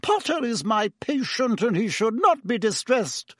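high, indignant insistence; angry. A narrator's reading. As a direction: scold intruders to protect your patient. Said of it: "said Madame Pomfrey angrily".

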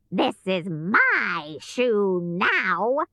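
slow, gloating witch voice; witch's shout, not very loud. A narrator's reading. Witch claims Dorothy's shoe, mildly shouted. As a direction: drag it out as a triumphant witch. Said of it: "she shouted".

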